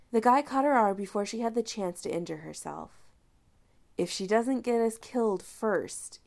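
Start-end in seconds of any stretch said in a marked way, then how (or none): none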